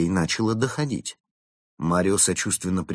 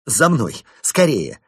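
urgent call; 6 semitones higher, 7 dB louder, slower than usual. Acted. Benedict hurries guests along.

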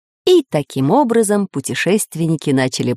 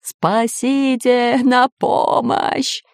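slow, higher cry for help; fearful wail; 6 semitones higher, much slower than usual. A narrator's reading. Pirate tossed into the air by steam, fearful wail. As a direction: stretch every word into a frightened wail. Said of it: "вопил от страха пират Бульбуль".

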